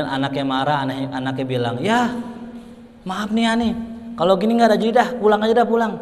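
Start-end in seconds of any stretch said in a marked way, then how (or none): 1.8-6.0 raised and sulky, quitting the game; fed up and ready to go home; irritated son. Acted. Son quits on his father in annoyance, raised and sulky. Son angry at being played with.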